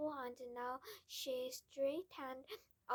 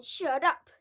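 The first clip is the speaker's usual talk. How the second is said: loud angry shout; 4 semitones higher, 17 dB louder than usual. A narrator's reading. Father silencing Matilda, loud angry shout. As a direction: cut her off hard. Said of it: "the father shouted".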